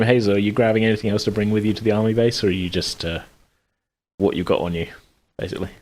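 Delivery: ordinary delivery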